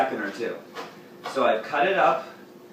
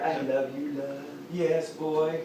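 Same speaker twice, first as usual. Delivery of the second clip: tender; 2 semitones higher, 5 dB quieter, slower than usual. Real, not acted. Tender words to cat.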